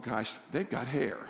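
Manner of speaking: low, slow, soft mock envy; pretend-sulky muttered comparison; envious aside. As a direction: mumble it wistfully, hushed.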